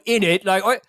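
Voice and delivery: lightly put-on East End tough guy; Ray Winstone. Joking about UK gambling ads, mock tough Cockney voice. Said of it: "in, like, the tough Ray Winstone voice".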